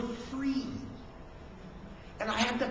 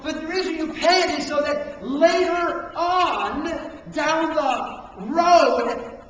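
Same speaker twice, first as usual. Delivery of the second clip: loud, higher, emphatic preacher delivery; forceful preaching; 5 semitones higher, 7 dB louder, slower than usual. Real, not acted. Preacher warning listeners of the short-term cost, forceful and loud. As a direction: drive each phrase home, stretching the key words.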